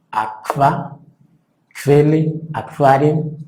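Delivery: aqua, kvele, aquarium: qu suena kv; pronunciación alemana. Shown as a demonstration. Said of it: "No suena la U". (qu alemana como kv)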